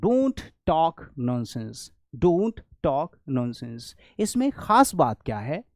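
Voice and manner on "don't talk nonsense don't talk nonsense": slow, careful teaching read; slow model sentence. Shown as a demonstration. Teacher modelling English slowly for Hindi learners. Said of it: "तो आप कहोगे".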